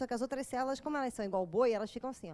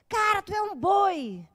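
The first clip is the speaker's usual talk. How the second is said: louder, slow, amazed announcement; excited revelation; 5 semitones higher, 8 dB louder, slower than usual. Acted. Enlightened ox telling another ox, louder and slow. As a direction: blurt the big discovery. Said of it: "olha pro outro boi e fala".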